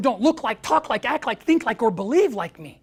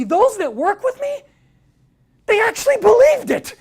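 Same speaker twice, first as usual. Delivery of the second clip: excited; 11 semitones higher, 8 dB louder than usual. Real, not acted. Marvelling at staff's belief.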